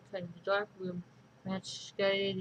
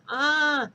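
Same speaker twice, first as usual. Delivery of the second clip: high, loud startled shriek; short shriek; 7 semitones higher, 9 dB louder than usual. Acted. Richard and adventurer shriek at the book creature, startled. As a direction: yelp in fright. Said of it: "they shrieked".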